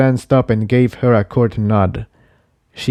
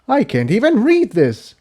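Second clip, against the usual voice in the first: higher, exasperated cry; higher colt voice, exasperated; exclaiming; 11 semitones higher than usual. Acted. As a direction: throw it out in exasperation. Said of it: "Jigsaw exclaimed".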